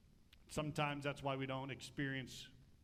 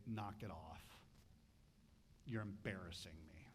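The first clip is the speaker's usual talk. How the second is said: much lower and softer, embarrassed parent's undertone; quiet scolding; 9 semitones lower, 9 dB quieter than usual. Retold or imitated. Father hushing a child in public, low and quiet. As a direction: scold under your breath. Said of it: "he can look at you and say".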